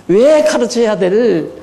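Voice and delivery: ordinary talk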